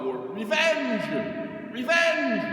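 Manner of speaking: loud, high shout of revenge; thundering cry. Real, not acted. Priest to congregation, a loud high cry. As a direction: hurl the word out to the pews.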